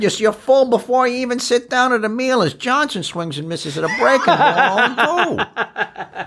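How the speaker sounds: put-on high-pitched old-time baseball announcer, laughing; Phil Rizzuto impression. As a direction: ramble about dinner like a folksy play-by-play man. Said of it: "Phil Rizzuto example".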